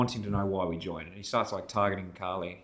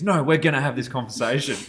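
louder, mock belligerent refusal; retold defiant insistence; 5 semitones higher, 9 dB louder than usual. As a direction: dig in and refuse to back down. Drunk guy refusing to drop it.

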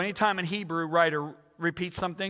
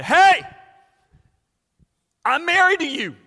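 mock proclamation to wife; 11 semitones higher, 12 dB louder than usual.

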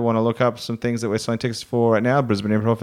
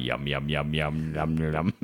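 low, soft savouring yum yum; low yum noise; 9 semitones lower, 9 dB quieter than usual. Retold or imitated. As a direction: murmur yum over something tasty. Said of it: "I'm like".

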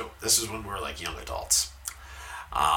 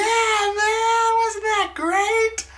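high, loud gushing; excited girl; 6 dB louder, much slower than usual. Retold or imitated. Loud girl gushing to the moviegoers, high excited voice. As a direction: gush like an excited fan. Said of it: "she went".

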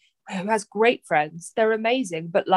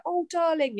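cooing sympathy; 9 semitones higher, 4 dB quieter than usual. Retold or imitated. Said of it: "Whereas I get".

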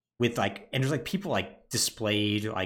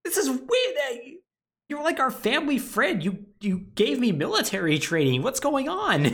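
mock outraged Ethan; 14 semitones higher, 4 dB louder than usual. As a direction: squeal it like a shocked husband. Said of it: "And Ethan's like".

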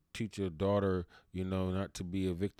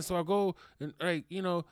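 ordinary talk

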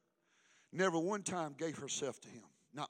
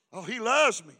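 loud insistence; 2 semitones higher, 11 dB louder than usual. Retold or imitated. Preacher loudly voicing the girl's insistence. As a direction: shout it out, sure of her love.

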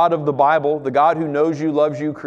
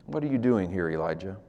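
hushed question; 8 semitones lower, 8 dB quieter than usual. Acted. God's question, quiet.

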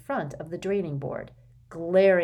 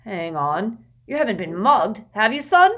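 gruff Uncle Vernon; 4 semitones higher, 9 dB louder than usual. Acted. Vernon asks son.

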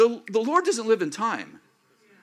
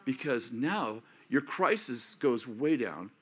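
usual speaking voice

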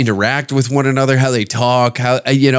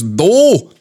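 put-on raised, stretched Minnesota-accent "Do"; 4 semitones higher, 3 dB louder than usual. Lady in the crowd calling out, mock Minnesota accent. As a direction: mimic her long Minnesota "Do". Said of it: "she's in the crowd and goes".

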